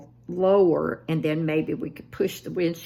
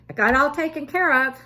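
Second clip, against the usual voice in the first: proud announcement; 10 semitones higher, 7 dB louder, faster than usual. Retold or imitated. Proud report to her daughter.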